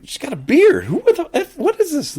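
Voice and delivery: retold shock at the bearded lady; shocked disbelief. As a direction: squeal it in total disbelief. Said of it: "I'm like".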